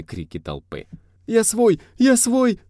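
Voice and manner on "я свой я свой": high wailing plea for mercy; tearful scream. A narrator's reading. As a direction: shriek it, begging and whiny. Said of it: "Плаксивый крик".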